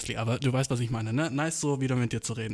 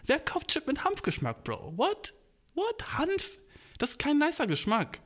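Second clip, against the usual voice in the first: high squeaky disbelief; what, hemp?!; incredulous; 11 semitones higher than usual. Real, not acted. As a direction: shriek your disbelief up high. Outraged disbelief at hemp flavour.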